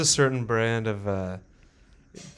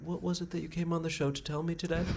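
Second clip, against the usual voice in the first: soft, higher-pitched interviewer voice; 7 semitones higher, 10 dB quieter than usual. Acted. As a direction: gently prompt the guest, mock-serious. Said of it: "and they're like".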